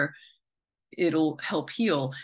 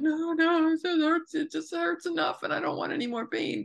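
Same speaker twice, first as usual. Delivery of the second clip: put-on much higher little-kid pleading voice; whiny child self; 12 semitones higher than usual. As a child refusing mom's tooth pull, high whiny plea. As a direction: whimper like a kid guarding a loose tooth. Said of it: "I'm like".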